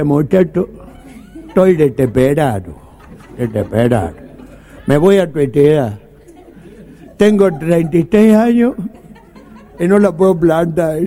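s sounds turned to t, "desesperado" said as "deteperado"; lisping speech defect. Retold or imitated. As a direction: speak as Miguelito, every s becomes a t. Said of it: "tenía un problema de dicción".

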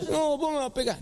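put-on high, pleading child voice; a boy begging his mother not to hit him; scared kid. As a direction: beg like a frightened boy. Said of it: "kid voice in dialogue".

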